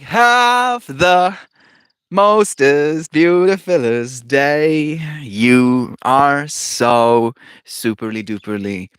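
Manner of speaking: loud, bubbly over-the-top reading; shower them with sugary praise, slowly in spots; gushing reading. Acted. Reads loving stream message.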